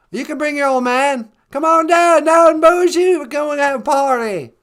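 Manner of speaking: hammed-up Texan invitation; George Bush impression. Bush inviting her husband down, loud folksy impression. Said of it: "George Bush's ranch".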